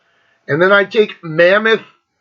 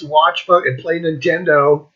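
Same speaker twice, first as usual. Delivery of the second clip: ordinary talk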